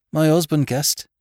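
high, loud, strained answer; hoarse answer. A narrator's reading. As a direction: force out a painful admission. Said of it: "she answered hoarsely".

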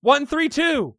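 high-pitched screamed battle call. Acted. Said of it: "I scream at him".

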